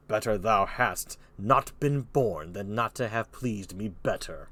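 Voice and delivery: slow, low kingly rebuke; King Lear. Acted. Lear rebuking Cordelia, slow low voice. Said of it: "There was Lear".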